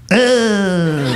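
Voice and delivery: imitated drawn-out "ugh" of revulsion; mock disgusted groan. Buddy glimpses him changing backstage, imitated disgust. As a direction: groan as if recoiling from the sight. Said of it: "He says".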